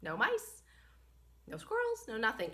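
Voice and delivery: usual speaking voice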